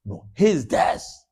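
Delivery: his desk slow and deliberate; stress on his. Shown as a demonstration. Teacher demonstrating emphasis on his desk. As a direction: drag out his desk slowly. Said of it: "emphasize his desk by saying".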